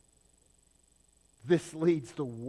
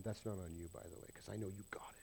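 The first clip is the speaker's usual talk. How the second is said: fast, softer reassuring whisper; whispered aside; 5 semitones lower, 14 dB quieter, faster than usual. Real, not acted. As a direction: let them in on it quietly.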